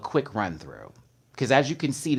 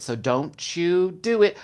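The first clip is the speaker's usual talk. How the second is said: ordinary talk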